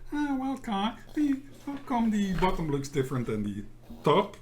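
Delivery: high, whiny mimicking of a complainer; mock the nitpicker with a whine; whining voice. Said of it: "stop using my whining voice. I can tell you're mimicking me".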